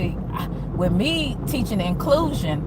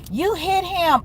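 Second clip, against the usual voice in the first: put-on sassy girl voice; 9 semitones higher, slower than usual. Sassy girls accusing her.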